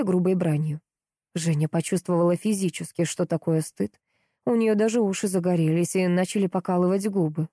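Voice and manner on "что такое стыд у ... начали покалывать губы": ordinary delivery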